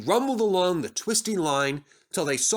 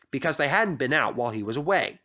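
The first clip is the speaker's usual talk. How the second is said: plain reading voice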